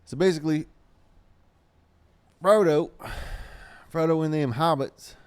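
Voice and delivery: put-on loud high-pitched old gaffer voice. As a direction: crank it up into the cranky old gaffer. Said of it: "That's my gaffer voice".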